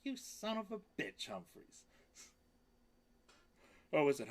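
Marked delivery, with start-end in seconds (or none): 0.0-1.3 high squeaky teasing cry; high mock-insult to a pet; playful pet squeal. Real, not acted. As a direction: squeal it at the cat in mock outrage.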